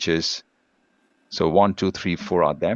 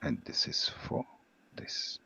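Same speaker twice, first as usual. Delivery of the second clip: whisper; 15 dB quieter than usual. Real, not acted. Quiet aside while organising notes.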